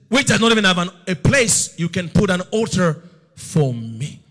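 a divine rebuke thundering down; booming voice of God. Retold or imitated. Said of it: "He said".

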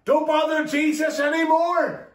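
retold shout. Messenger shouting bad news to Jairus, mild high-pitched shout. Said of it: "somebody shouted".

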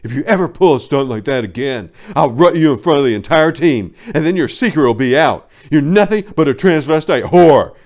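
threatening. A narrator's reading. Prancer threatening Vixen before leaving, loud menacing. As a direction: warn her with cold fury. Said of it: "he snapped threateningly".

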